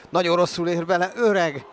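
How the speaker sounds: excited commentary. Real, not acted. Near own goal.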